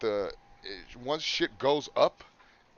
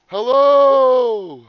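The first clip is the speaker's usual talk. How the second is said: loud hello; 12 semitones higher, 16 dB louder than usual. Real, not acted. Checking audio after echo trouble.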